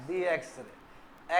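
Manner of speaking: slow, soft, low "दी" before "एक्सरे"; "दी" with an ee vowel, drawn out; "the" as dee. Shown as a demonstration. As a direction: say "दी एक्सरे" slowly as dee x-ray. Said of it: "इसको क्या पढ़ोगे".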